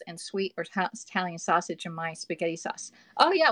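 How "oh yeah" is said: excited agreement. Real, not acted. Agrees with viewer Elaine.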